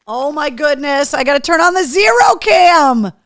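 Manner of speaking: loud, high burst of delight; excited shout. Real, not acted. Thrilled by gift, calling out.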